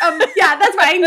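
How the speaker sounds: high, loud, laughing give-up; laughing defeated. Retold or imitated. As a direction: cave in with a laugh. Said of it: "I was like".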